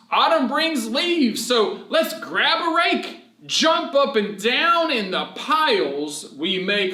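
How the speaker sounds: high, slow sing-song verse; cheerful rhyme for children; poem reading. Acted. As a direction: read it bouncy for little kids. Reads rhyming verse.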